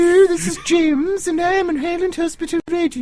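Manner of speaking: hammed-up posh receptionist voice; posh telephone voice. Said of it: "posh telephone voice".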